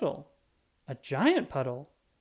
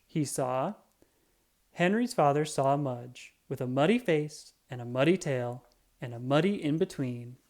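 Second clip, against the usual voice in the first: ordinary delivery, nothing marked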